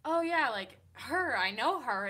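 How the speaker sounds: light up as you suddenly recognize her; mock excited "oh yeah, I know her"; excited recognition. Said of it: "I was like".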